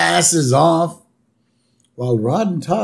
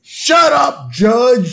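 much higher, loud yell; shouted; 9 semitones higher, 6 dB louder, much slower than usual. Retold or imitated. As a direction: bark it at the judge. Homer yells at judge.

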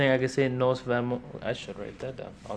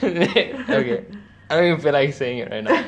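after laughing hard; 4 semitones higher, 6 dB louder than usual. Real, not acted. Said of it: "I need a second".

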